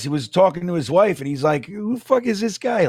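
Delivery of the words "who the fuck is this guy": imitated high-pitched puzzled stranger; baffled stranger. Man behind in line asks his wife, imitated bafflement. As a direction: mimic a man asking in disbelief. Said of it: "the guy behind us".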